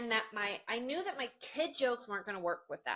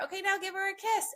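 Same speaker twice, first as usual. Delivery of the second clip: cheery photo direction; 11 semitones higher, 4 dB louder than usual. Retold or imitated. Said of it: "I've said".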